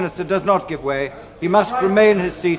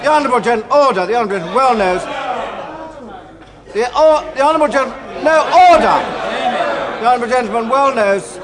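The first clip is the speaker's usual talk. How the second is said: high loud stammering calls for order; heated parliamentary debate; 4 semitones higher, 4 dB louder than usual. Real, not acted. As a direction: keep shouting over the uproar. Speaker fights to restore order.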